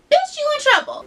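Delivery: high sassy scolding God. Retold or imitated. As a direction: scold her like a sassy diva. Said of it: "God's like".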